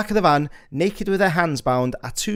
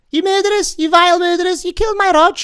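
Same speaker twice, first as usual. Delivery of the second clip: high, loud shrieking accusation; furious accusing scream; 8 dB louder than usual. Acted. Grieving accuser screams at the suspected murderers. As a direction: scream it at the killers.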